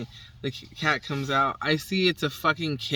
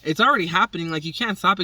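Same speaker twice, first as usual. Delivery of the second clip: louder, raised retort; a hint of the ex snapping back; lightly voiced ex; 4 semitones higher, 6 dB louder, faster than usual. Ex telling her she can't stop it, louder.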